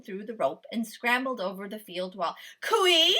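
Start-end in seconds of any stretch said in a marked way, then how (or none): 2.6-3.2 higher, louder howled name. A narrator's reading. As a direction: howl after him. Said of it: "he howled".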